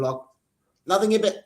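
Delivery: usual speaking voice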